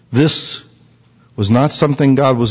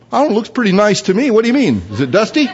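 mock innocent kid; 8 semitones higher, faster than usual.

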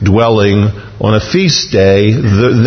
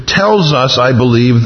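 ordinary talk

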